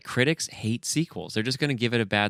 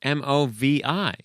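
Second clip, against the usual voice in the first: spelled out slowly; 4 semitones higher, 3 dB louder, slower than usual. Shown as a demonstration. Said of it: "Wait, movie".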